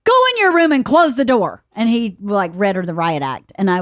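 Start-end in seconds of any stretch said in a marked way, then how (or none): 0.0-1.6 mock stern order. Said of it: "And Bert was like".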